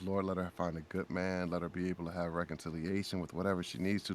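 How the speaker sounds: low, soft, quickening plea; hushed private prayer; retold prayer. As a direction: pray quietly under your breath. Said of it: "walked away and prayed about".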